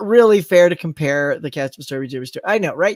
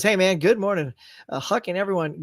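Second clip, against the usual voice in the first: usual speaking voice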